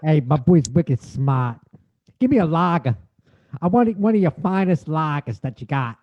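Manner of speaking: put-on Boston accent, r's dropped. Bostonian bragging, then ordering a lager, Boston accent. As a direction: play a Bostonian ordering at the bar. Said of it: "You do a Boston accent".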